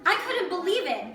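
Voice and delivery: plain reading voice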